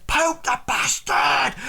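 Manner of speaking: high, rowdy yell; heckler in the crowd. Acted. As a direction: egg the mob on at a yell. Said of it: "Somebody says".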